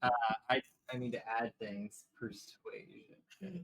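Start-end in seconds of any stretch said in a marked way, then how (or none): 2.2-3.6 slow, soft, high singsong call; mermaid voice. Pretend. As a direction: lull the fish like a mermaid. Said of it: "my mermaid voice".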